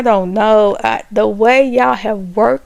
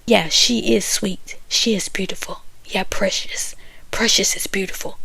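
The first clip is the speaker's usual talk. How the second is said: hushed mock whisper; 4 semitones lower, 8 dB quieter than usual. Vlogger imitating Labrina agreeing about Precious, whispered. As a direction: say it under your breath like gossip at the table. Retelling cast member's hushed reply.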